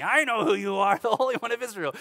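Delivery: high, loud pretend outburst; hammed-up screaming, short of full force; mock scream. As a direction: act out someone suddenly screaming at the preacher. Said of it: "starts screaming at me".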